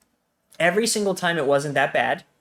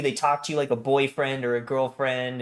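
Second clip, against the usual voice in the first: ordinary talk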